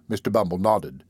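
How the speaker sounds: usual speaking voice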